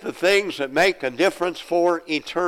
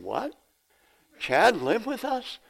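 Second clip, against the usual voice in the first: high, incredulous questioning; mock astonishment, pitch leaping up; astonished disbelief; 8 semitones higher, 4 dB quieter than usual. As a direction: act out being caught totally unaware. Retells his shock at forgotten fact.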